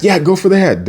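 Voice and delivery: high mock command; acted insistence. Acting Raph insisting on his order.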